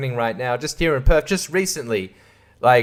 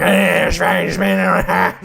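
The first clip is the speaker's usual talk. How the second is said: loud slurred mock yobbo babble; hammed-up drunken Aussie noise; rowdy drunk Australians; 6 semitones lower, 4 dB louder, slower than usual. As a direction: garble it like a boozed-up bloke. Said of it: "and just being like".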